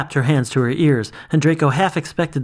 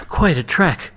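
puffing after the climb; a little winded; out of breath; 4 semitones higher than usual. Acted.

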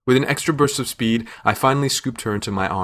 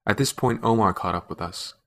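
ordinary delivery, nothing marked

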